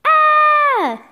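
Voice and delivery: shrill loud cartoon scream; high scream. Scream in comic.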